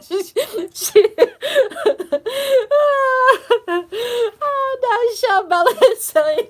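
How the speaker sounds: high-pitched helpless laughter; laughing hard. Real, not acted. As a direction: laugh until the voice squeaks. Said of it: "I'm laughing because".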